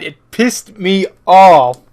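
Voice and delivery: heated rant. Real, not acted. Player vents about the character's death, slow and loud. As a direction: drag each word out in frustration.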